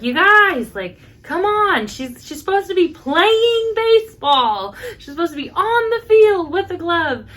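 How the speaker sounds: calling out. Retold or imitated. Aunt calling the cousins back to the field, calling out. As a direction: holler at the kids, exasperated and high.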